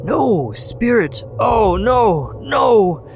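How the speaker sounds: high, loud, drawn-out "No, spirit!" breaking into a shout; anguished cry. A narrator's reading. Scrooge begging the spirit at his own grave, anguished shout. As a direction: cry out in terror, pleading.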